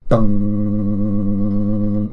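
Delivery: long, loud vibrating "dung"; vibrating string dung. Shown as a demonstration. Streamer testing the vibro hands technique, long vibrating dung. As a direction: imitate a low string buzzing on. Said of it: "That has a vibrating sound to it".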